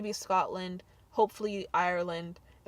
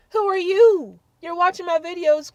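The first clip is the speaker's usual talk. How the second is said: higher, louder, incredulous; indignant callout; 9 semitones higher, 10 dB louder than usual. Real, not acted. To hateful commenters, loud indignant challenge. Calling out haters.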